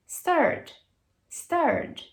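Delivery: "stirred" with just a small D at the end; "stirred", R straight into a final D; correct ED pronunciation. Shown as a demonstration. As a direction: model "stirred" high and falling, one syllable. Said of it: "Só um Dzinho no final".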